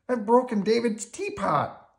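high, loud puppet wail; dismayed cry. A narrator's reading. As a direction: wail the confession in a panic. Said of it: "cried Bert".

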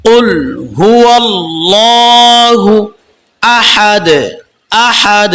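আহাদ ending with a bounced দ, slow chant; tajweed recitation. Shown as a demonstration. Teacher shows qalqala on আহাদ, slow chant. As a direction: chant it, give the final দ a little push. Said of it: "লাস্টটা কলকলা আছে".

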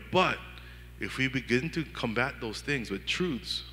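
ordinary talk

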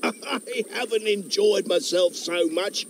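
frog chuckling after a laughing fit; delighted frog. Acted. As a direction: gasp it out, still tickled with laughter.

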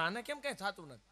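ordinary talk, nothing marked

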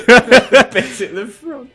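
high, loud, slow words through laughter; helpless laughter while repeating a phrase; cracking up laughing. Real, not acted. Co-host repeating the rude anatomy explanation, cracking up laughing.

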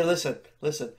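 ordinary delivery

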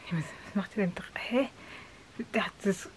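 puzzled unvoiced whisper. Real, not acted. Wondering to herself why Daniel waits outside, in a whisper.

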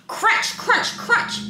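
high crisp crunch words; high loud crunches; crunching sound. Acted. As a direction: make it sound like biting. Sound words read expressively.

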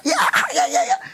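very high, laughing yeah yeah; teen handed the garage keys, giddy and laughing; giddy yes. Retold or imitated. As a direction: squeal it out with delight. Boy overjoyed accepting keys.

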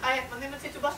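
put-on high, caricatured accented introduction; squeaky mock stage voice; put-on Tituba voice. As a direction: imitate the hammy Tituba reading. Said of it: "And I said".

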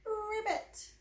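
high, soft ribbit; gentle frog ribbit for the baby; frog croak. Acted. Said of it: "He says".

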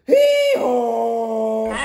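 loud braying hee-haw like a donkey. Acted. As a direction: bray it out like a donkey. Donkey bray in read-aloud.

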